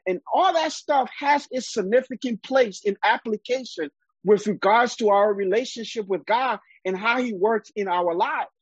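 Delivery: ordinary talk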